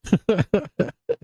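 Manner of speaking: high-pitched run of laughter; high giggling burst; real laugh. Real, not acted. Laughs amid anger at losses.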